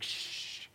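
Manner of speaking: long, soft "shh"; hushing shush. Retold or imitated. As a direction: shush a noisy talker. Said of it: "everybody's like".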